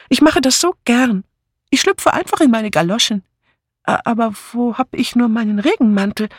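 high, fluttery and flustered; fussy old lady. Acted. Bunny voiced by narrator. (hohe, flatterhafte Stimme einer zerstreuten alten Dame)

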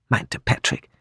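usual speaking voice

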